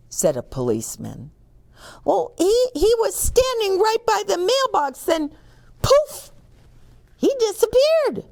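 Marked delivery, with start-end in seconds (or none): 2.1-8.3 high, fast, breathless telling; excited witness. Acted. Policeman hears witness describe vanishing bear. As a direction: build to the poof.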